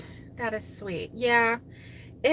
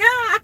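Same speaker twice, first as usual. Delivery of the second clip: mock shriek; 11 semitones higher, 11 dB louder than usual. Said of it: "And I'm like".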